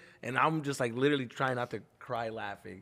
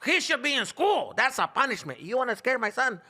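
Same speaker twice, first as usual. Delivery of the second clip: voicing dad; 11 semitones higher, slower than usual. Son imitating dad mocking school punishment, hammed-up dad. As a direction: ham up a fed-up father lecturing. Dad's rant about school.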